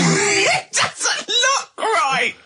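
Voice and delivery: words squeezed out through helpless laughter; laughing. Real, not acted. [name] echoing Carl's line, laughing hard. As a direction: repeat it while cracking up.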